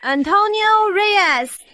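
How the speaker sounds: high, stretched-out shout; calling out. Acted. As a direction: try hollering the name into the distance. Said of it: "Subukan mo nga".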